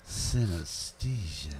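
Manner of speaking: slow, suggestive Synesthesia; drawn out like a dirty word; naughty. Shown as a demonstration. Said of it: "Ooh, that sounds naughty".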